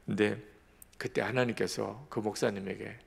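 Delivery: ordinary talk, nothing marked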